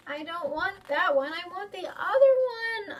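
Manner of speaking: put-on bawling child. Child refusing papa's new coin, bawling. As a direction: bawl it out in protest. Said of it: "I bawl".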